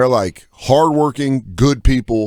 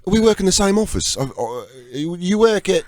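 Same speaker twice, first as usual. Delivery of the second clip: high, put-on London stranger voice; London accent; 7 semitones higher than usual. Stranger insisting they share an office, London accent. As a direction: imitate an insistent Londoner fumbling for words. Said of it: "I was in London".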